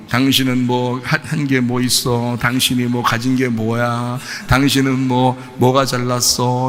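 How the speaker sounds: low, slow wifely needling; soft-spoken nagging. Acted. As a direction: nag softly and slowly on a low, level pitch. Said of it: "부드럽게 얘기하면서 막 조곤조곤 뭐". (낮고 느리게 조곤조곤 따지듯)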